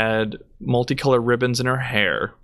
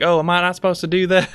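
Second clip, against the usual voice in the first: higher, fast innocent question; mocking tourist; 7 semitones higher, faster than usual. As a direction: play innocent like a clueless tourist. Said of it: "it's like".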